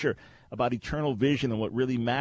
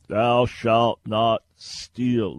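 very slow, loud, word by word; solemn commandment; 5 dB louder, much slower than usual. Acted. Host quoting the written commandment as the answer, slow proclamation. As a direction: proclaim it slowly, weighting every word.

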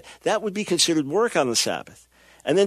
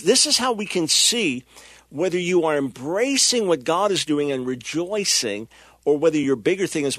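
ordinary talk

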